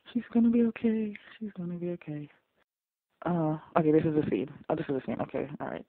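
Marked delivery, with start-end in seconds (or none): 0.1-1.1 hopeful insistence. Real, not acted. Hopeful reassurance, first take. 1.4-2.3 soft reassurance. Real, not acted. Same reassurance said softly.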